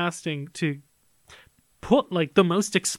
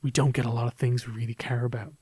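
hushed quote; 4 semitones lower than usual. Retold or imitated. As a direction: whisper it low after a pause. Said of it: "he just takes a pause".

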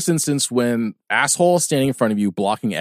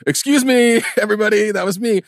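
mock announcement; 9 semitones higher than usual. Mock public fart confession.

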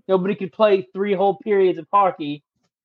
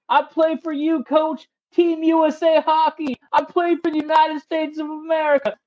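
proud player declaration; 8 semitones higher than usual. Retold or imitated. Exhausted player finally gets it.

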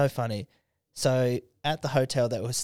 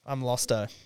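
ordinary delivery

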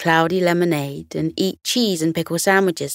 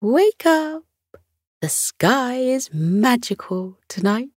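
cooing; 6 semitones higher than usual. Acted. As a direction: coo his name gently. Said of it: "he cooed".

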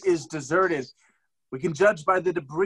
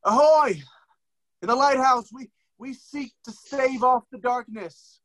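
loud hail, raised high; calling out; 7 semitones higher, 4 dB louder than usual. Acted. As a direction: shout it across to the lighthouse. Calling out to the lighthouse.

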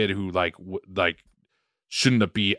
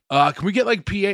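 obnoxious mock call; 9 semitones higher than usual. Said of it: "being, like, obnoxious and being like".

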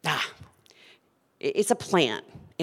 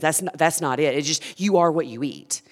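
ordinary talk, nothing marked

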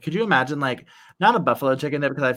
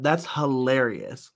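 usual speaking voice

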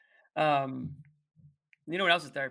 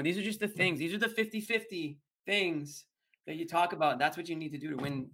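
usual speaking voice

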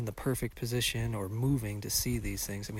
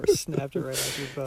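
laughing; 3 semitones higher, 6 dB louder than usual. Real, not acted. Teasing friend about broken stabilizer.

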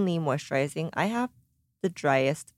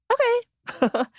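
put-on high, bright little girl's okay; chirpy little girl. Mom voices her daughter agreeing, high little-girl okay. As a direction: squeak out a child's agreeable okay.

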